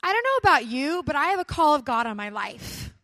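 a bold stand in front of friends; firm declaration. Retold or imitated. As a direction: announce it boldly to the car. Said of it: "and I said".

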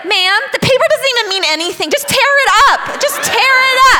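high, loud, escalating plea; hammed-up frantic urging, rising to near yell; freaked-out officer imitation. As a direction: imitate a panicking cop begging someone to stop crying. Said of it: "he's, like, totally freaked out".

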